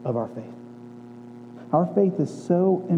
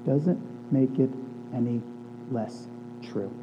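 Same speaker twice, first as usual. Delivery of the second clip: lower, softer earnest appeal; tender sermon close; 8 semitones lower, 7 dB quieter than usual. Real, not acted. Pastor reassuring his church, soft and earnest. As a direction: reassure the church gently, voice dropping low.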